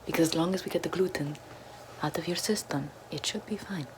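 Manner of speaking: ordinary talk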